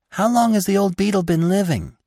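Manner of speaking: high little midge voice; tiny voice. Acted. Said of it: "some very small midges asked".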